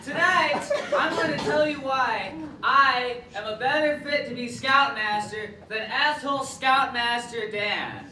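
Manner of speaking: a hammed-up camp leader greeting the kids; lightly voiced, boisterous scoutmaster; scoutmaster character. Character act Scoutmaster Dave.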